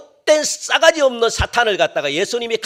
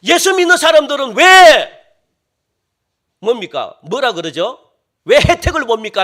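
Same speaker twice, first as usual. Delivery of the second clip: loud, high outraged protest; indignant unbeliever; 5 semitones higher, 9 dB louder than usual. Retold or imitated. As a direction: shout the unfairness at God. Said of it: "안 믿는 사람들이 그걸 보고". (억울해서 따지는 불신자 흉내)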